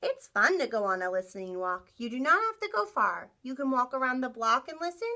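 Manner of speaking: storybook voice for the class; reading to children. Acted. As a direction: read it like a picture book to kids. Reads picture book to class.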